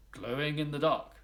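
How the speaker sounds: usual speaking voice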